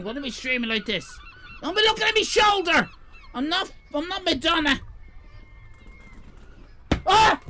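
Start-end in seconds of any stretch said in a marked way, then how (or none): 1.6-4.8 indignant yell. Real, not acted. Streamer telling viewers off for looking, indignant yell. Snapping at viewers while exposed. 7.1-7.4 loud, high yelp; sudden yelp. Real, not acted. Streamer struggling on stream, sudden loud yelp.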